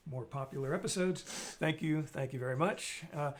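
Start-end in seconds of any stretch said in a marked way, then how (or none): none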